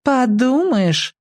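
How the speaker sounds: put-on high, drawn-out girlish scoff; dismissive girl. A narrator's reading. Skinny girl brushing off the boy's jump, dismissively. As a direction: stretch it out high and disdainful. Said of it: "девчонки всегда говорят".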